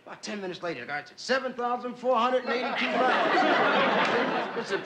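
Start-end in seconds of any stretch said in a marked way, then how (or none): 1.2-4.6 slow report. Acted. Sailor reporting anchor weight.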